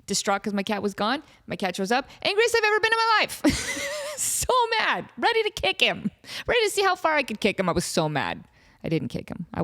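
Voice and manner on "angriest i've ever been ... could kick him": indignant. Retold or imitated. Retelling peak anger, voice raised.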